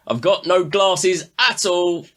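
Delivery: high, drawn-out mock call; stretched-out, high-pitched complaint across the bar; retold shouting. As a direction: re-enact a harried bartender calling out. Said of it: "a couple of minutes shouting".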